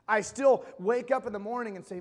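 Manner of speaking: ordinary delivery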